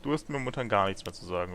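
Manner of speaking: normal talking voice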